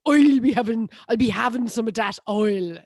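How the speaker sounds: hammed-up stage-Irish, loud at the start; mock Irish accent. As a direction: parody a tutorial's bad Irish accent with big "oi" vowels. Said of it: "she basically has the sentence".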